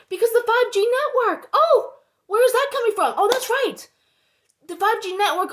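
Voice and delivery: high-pitched mock surprise; sarcastic realization. Answering their own why about the network, sarcastically. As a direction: feign a sudden discovery, pitched well up.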